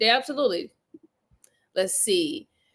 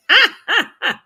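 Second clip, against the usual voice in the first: laugh; 7 semitones higher, 8 dB louder than usual. Real, not acted. Laughs after own mock voice.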